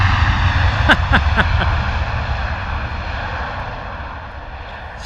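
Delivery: long airy laugh at the landing; real laugh after a hard landing; long breathy laugh. Real, not acted.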